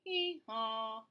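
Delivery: braying donkey call; donkey hee haw. Shown as a demonstration. Teacher showing children the donkey sound, braying. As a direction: bray it like a donkey. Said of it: "It says".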